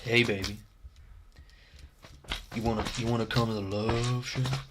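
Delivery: soft, slightly low come-on; mock seductive. Streamer inviting someone to the love shack, mock seductive. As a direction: croon a creepy invitation, softly. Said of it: "that's weird".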